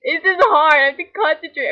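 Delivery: laughing hard. Real, not acted. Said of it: "I'm crying".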